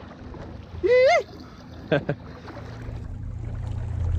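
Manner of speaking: high breathy laugh. Real, not acted. Friend laughs loudly.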